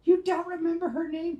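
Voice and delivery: squeaky high mocking voice; mock listener voice. Listener teasing her in a high squeal.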